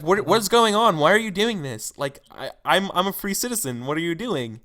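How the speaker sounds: a hooded man begging to know what's happening; panicked prisoner. Acted. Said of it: "and he's like".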